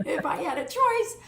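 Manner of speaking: breaking into a real laugh, pitch jumping up; laughing out the end of the line. Real, not acted. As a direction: say it through a laugh at your own joke. Jokes about her own marriage.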